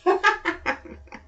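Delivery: high, breathy villain laugh; villain laughing off the brother's plea; mocking laugh. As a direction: laugh in his face.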